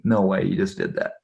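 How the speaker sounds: soft, fading under the breath; muttered disbelief. Retold or imitated. Father's disbelieving thought.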